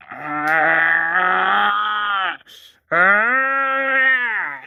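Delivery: imitating strained choking gasps; a mock choking sound; choking groans. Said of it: "And he's like".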